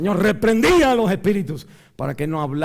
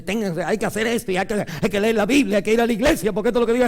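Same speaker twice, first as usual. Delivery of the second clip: rising, rattled off fast, building louder; mock preachy rules; 6 semitones higher, 4 dB louder, faster than usual. Preacher mocking legalistic preaching, building to a shout. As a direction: mimic a nagging legalist preacher. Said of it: "en vez de".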